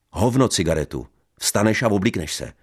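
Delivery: loud, high curt refusal; angry refusal. Acted. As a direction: shout the order. Husband snaps refusal. (vzteklé odmítnutí)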